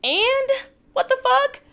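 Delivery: real incredulous outburst; reacting to the show in loud shocked disbelief. Real, not acted. Reacts to Brittany's texting reveal.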